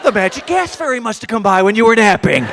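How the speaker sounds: loud, mocking sing-song; sarcastic retort. Husband mocking his wife's confusion, sarcastic. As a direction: lay the sarcasm on thick.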